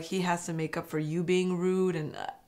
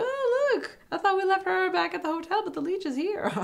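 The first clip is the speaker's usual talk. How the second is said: much higher snide teasing voice; mocking joke voice; 13 semitones higher than usual. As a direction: tease her rival with a high sneer. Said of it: "She's like".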